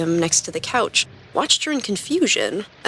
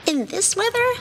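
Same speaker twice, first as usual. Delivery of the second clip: incredulous; 13 semitones higher than usual. A narrator's reading. Girl incredulous at surfing in storm.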